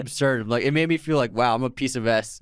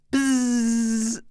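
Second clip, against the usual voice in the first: squealed high buzz; high buzzing noise; 12 semitones higher than usual. Acted. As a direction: buzz like a frantic DJ. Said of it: "remember the bzzz".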